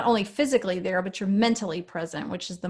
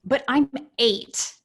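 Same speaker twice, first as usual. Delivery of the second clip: put-on higher, louder, very slow child protest; indignant kid voice; 5 semitones higher, 4 dB louder, much slower than usual. Mom quoting her son objecting to being told I love you, slow indignant. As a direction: drag it out like an offended eight-year-old. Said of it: "And he goes".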